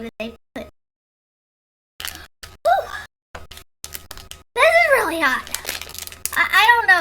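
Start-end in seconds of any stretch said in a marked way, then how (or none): 2.6-3.4 sharp high whoop from the heat; pained whoop. Real, not acted. Hot spicy candy reaction. 4.5-7.0 higher, louder, pained exclamation; burning mouth. Real, not acted. Reacting to spicy candy.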